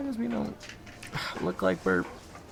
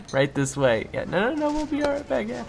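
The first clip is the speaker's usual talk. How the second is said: ordinary talk, nothing marked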